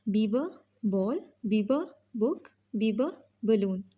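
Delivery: ब clipped short before each word, slowly; teacher drilling b words; slow phonics drill. Shown as a demonstration. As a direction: sound out ब then the word. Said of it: "बी का sound होता है ब".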